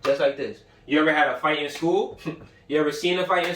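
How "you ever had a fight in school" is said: ordinary talk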